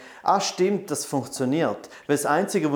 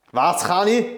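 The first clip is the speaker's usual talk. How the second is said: raised, insistent repeat of the question; louder repeat; 5 semitones higher, 6 dB louder than usual. Real, not acted. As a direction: ask it again, louder and higher.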